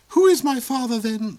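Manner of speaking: high, loud pleading cry; the princess crying out for her father; loud desperate question. A narrator's reading. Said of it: "the princess cried".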